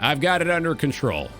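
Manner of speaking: ordinary delivery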